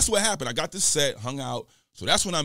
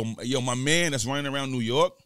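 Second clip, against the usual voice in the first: ordinary talk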